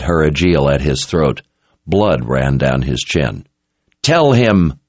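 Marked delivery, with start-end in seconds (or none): 4.0-4.7 higher, firm order; mildly yelled command, cut short; restrained yell. A narrator's reading. Kara demands answers from the captured man, restrained yell. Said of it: "she yelled at the man".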